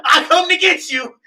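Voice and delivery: high, loud call through the pipes; booming protector-mode holler; protective shout. Retold or imitated. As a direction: yell out to a stranger in trouble. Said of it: "switched to immediate protector mode".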